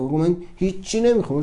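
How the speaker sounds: usual speaking voice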